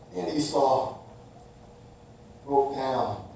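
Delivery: soft, low, slowing telling; hushed narration. Acted. Preacher quietly ending the story before the lesson, hushed. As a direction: let it fall quiet.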